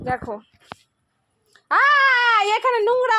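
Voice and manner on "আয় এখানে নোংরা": calling out loud. Real, not acted. Calling child away from dirt.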